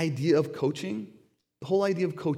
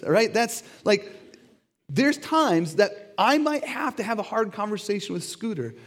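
ordinary talk, nothing marked

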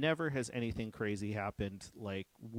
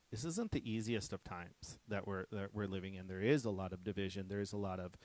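usual speaking voice

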